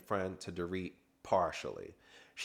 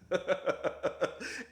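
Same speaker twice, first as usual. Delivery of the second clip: short high-pitched burst of laughter; amused high giggle; 10 semitones higher than usual. Real, not acted. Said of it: "that was funny".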